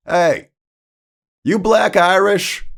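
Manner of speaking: high, loud holler; yelled across a room. Retold or imitated. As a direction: call out bluntly to someone far off. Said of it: "he yelled across the diner".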